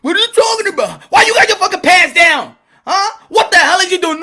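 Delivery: high, mock-furious scolding; outraged girlfriend. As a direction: burst out in high-pitched comic outrage. Acted girlfriend confronts him.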